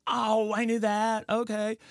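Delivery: high, drawn-out mock-knowing voice; retold Aunt Snooki. Aunt covering up to the celebrity, high acted assurance. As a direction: act out an aunt pretending she knew all along. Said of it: "And she's like".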